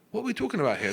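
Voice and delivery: high-pitched bewildered question; baffled. Retold or imitated. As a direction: act out being totally lost. Retold confusion at friends' questions.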